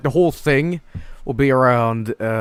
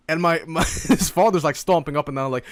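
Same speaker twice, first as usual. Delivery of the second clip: usual speaking voice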